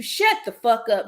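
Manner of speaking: ordinary talk, nothing marked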